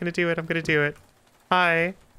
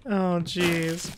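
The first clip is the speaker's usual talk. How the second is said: ordinary talk, nothing marked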